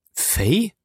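high, rising note of surprise; astonished. Acted. Jim hears who has come to see him, astonished. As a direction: ask it in astonishment, pitch lifting sharply. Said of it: "fragte Jim erstaunt".

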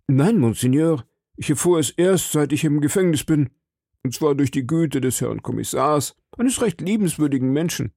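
frightened shopkeeper pleading with the cardinal; nervous shopkeeper. A narrator's reading. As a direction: play the anxious little shopkeeper. Timid shopkeeper voice.

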